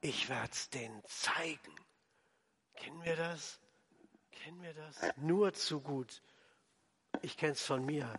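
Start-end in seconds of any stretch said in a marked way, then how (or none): 0.0-1.7 low, soft resolve; grim private vow. Acted. Acted bitter inner vow, ending. 2.8-3.6 quietly asked of the congregation; soft searching question. Real, not acted. Confiding question to congregation, repeated. 4.3-5.0 very soft, almost to yourself; hushed searching question. Real, not acted. Same question repeated, almost inaudible.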